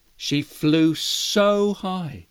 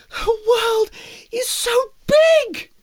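high, slow wonder; awed mouse; 3 dB louder, slower than usual. Acted. Mouse tells the eagle, high and slow with awe. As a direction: let the size sink in.

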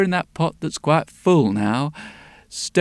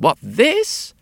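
higher, startled question; surprised old woman; 6 semitones higher than usual. A narrator's reading. Old woman handed the potion, lightly surprised. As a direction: recoil at the cup. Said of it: "she says".

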